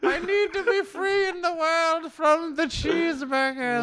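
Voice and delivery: high, slow, hammed-up villain rasp; belly begging for freedom like a cartoon villain; Skeletor-like stomach voice. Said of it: "Why does he sound like Skeletor?".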